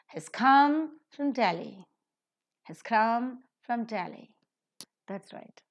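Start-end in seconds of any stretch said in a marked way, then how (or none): 0.1-1.9 slow, come aspirated and lifted, from weak; accent come and Delhi; stress on come and Delhi. Shown as a demonstration. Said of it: "So how do you pronounce it?". 2.6-4.3 slow, come aspirated and lifted, from weak; accent come and Delhi; stress on come and Delhi. Shown as a demonstration. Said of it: "So how do you pronounce it?".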